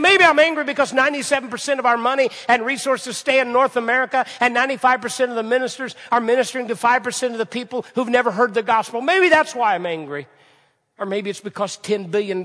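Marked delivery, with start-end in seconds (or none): 0.0-10.3 loud, high indignant rant; imitating angry preacher. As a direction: pour out his anger.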